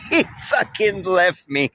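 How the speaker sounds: can't believe he got left behind; laughing disbelief. Real, not acted. Laughing disbelief at being ditched.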